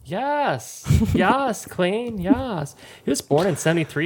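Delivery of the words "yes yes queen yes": playful gushing. Real, not acted. Gushing over handsome actor.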